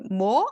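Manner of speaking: 뭐 with the pitch swept upward; rising question. Shown as a demonstration. Teacher imitating someone who didn't catch it, rising 뭐. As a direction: lift 뭐 like asking someone to repeat. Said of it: "하면서 올리잖아요".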